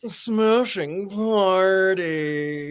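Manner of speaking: very slow clenched-jaw upper-crust drawl; Locust Valley lockjaw. Retold or imitated. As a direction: say it through a locked jaw. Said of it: "the Locust Valley lockjaw".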